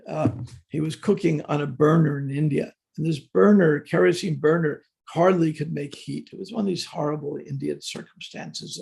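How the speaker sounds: ordinary talk, nothing marked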